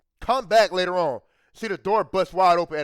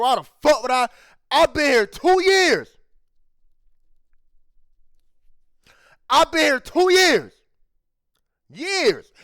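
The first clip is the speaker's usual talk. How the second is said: loud, slow and much higher, hammering each word; slow furious insistence; heated rant; 6 semitones higher, 6 dB louder than usual. Pretend. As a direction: pretend indignant shout at the coworker. Imagined angry rant at coworker.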